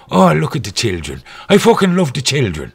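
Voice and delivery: hammed-up Irish brogue; Irish priest accent. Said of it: "I chose an Irish one".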